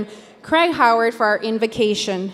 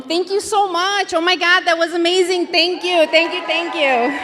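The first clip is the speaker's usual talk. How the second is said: excited; 5 semitones higher than usual. Real, not acted. Thrilled host thanks bidders.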